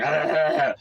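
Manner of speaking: imitated mocking grunt of a laugh. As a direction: mimic him sitting there chortling. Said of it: "sitting there like".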